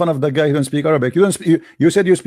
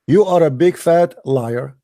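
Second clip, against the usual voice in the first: ordinary delivery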